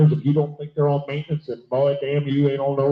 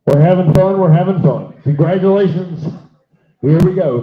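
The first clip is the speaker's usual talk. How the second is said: loud, excited announcing; 7 dB louder than usual. Real, not acted. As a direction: call it out over the crowd. Announcing sale over crowd.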